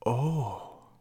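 drawn-out, astonished "Oh!"; stunned puppet's long oh; prolonged oh of astonishment. A narrator's reading. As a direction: gasp it out in wonder. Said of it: "gave her a prolonged "Oh!" of astonishment".

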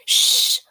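loud, sharp shush; urgent shushing. A narrator's reading. Hushing him under the invisibility cloak.

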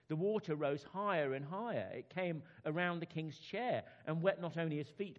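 storyteller voice for a bedtime tale; children's story narration. Acted. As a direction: read it like sitting kids down for a story. Reads children's story aloud.

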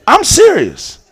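usual speaking voice

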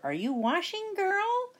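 high sing-song witch call. A narrator's reading. Witch calling through the door to Sasha, high sing-song. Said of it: "Bony Legs called through the door".

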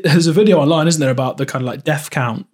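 normal talking voice

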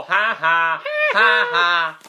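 mocking bully's "ha ha"; taunting schoolyard cackle; Nelson Muntz laugh. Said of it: "You might as well have Nelson Muntz just show up and go".

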